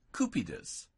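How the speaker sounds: Cupidus with a hard K at the start. Shown as a demonstration. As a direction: say Cupidus with C as in keep. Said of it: "C is always a hard K sound".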